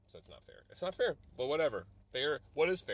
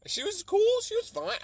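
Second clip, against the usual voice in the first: squeaky high gushing, much higher; mock gushing; 9 dB louder than usual. Gushing about the actress in a squeaky high voice. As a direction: gush about her way up high.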